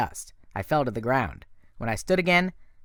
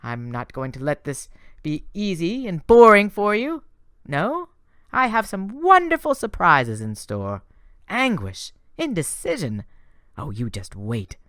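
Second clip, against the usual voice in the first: higher, sweetly teasing goddess voice; Aphrodite, sly and gushing; 6 semitones higher, 5 dB louder than usual. A narrator's reading. Goddess teasing Percy about her secret help, higher and sweet. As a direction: purr it like a doting goddess. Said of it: "she said".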